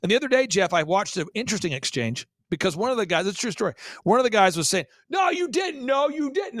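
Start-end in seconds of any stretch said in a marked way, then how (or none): 5.1-6.6 high-pitched mock shouting match; imitated yelling argument. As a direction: imitate a stubborn, hollered denial. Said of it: "They're just yelling".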